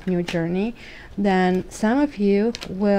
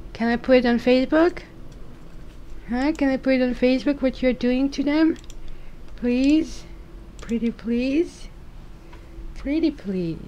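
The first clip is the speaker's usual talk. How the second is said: higher mock begging of the cat; higher pleading to the cat; playful pleading; 5 semitones higher than usual. Real, not acted.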